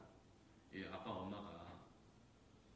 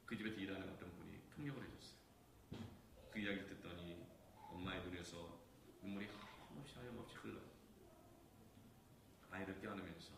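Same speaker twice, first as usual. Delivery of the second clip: ordinary delivery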